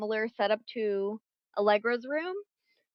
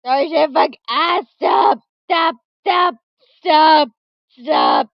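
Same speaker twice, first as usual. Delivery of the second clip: loud frantic yelling; yelling stop; 6 semitones higher, 13 dB louder, slower than usual. Real, not acted. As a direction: shout over the noise. Alarm going off, yelling stop.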